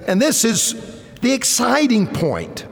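preacher reading verse thirteen, fervent; excited preaching. Acted. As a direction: announce the exciting point with fire. Exciting point of sermon.

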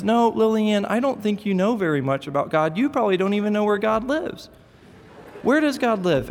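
put-on high, smug little boy quizzing his sister; know-it-all little boy. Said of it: "And he says".

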